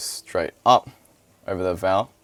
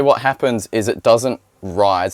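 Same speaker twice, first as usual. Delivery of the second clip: ordinary talk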